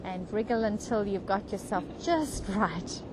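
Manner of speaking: unhurried, even meditation voice with long pauses; guided meditation. Real, not acted. Meditation leader guiding a group on the beach into comfort, calm guided meditation. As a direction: lead them gently, phrase by phrase, leaving space.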